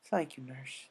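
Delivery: soft, faltering, stammered thanks; shaky thanks. Acted. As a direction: hold back tears as you thank her. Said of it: "A J shakily said".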